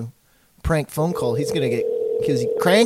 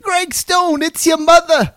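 much higher prank-call mother voice; man doing a woman's voice; 8 dB louder, slower than usual. Pretend. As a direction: squeak it out like a fake mom. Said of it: "my best jerky boy lady voice".

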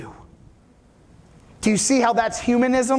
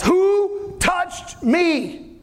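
very slow, high call to the crowd; a searching shout, drawn out; 7 semitones higher, much slower than usual. Acted. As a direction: stretch it out over the crowd. Jesus calls to the crowd.

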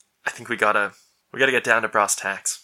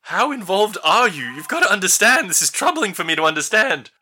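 higher, pressing parental voice; fretful father; 8 semitones higher than usual. Acted. Father Enos pressing his daughter, worried and raised. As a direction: lean on her like a worried dad. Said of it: "father questioning daughter".

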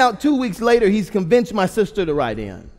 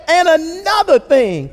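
slow, high, booming mock shout; imitated dad yelling; 8 semitones higher, 7 dB louder, slower than usual. Dad adding to his rant at the kids, yelling. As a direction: holler it like dad launching another point. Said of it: "he starts yelling at us".

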